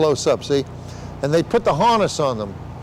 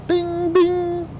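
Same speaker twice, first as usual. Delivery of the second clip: high "bing" sound effect; 12 semitones higher than usual. Retold or imitated. Imitating flea hitting its head.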